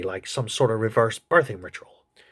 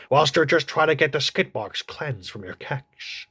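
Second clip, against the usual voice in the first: ordinary talk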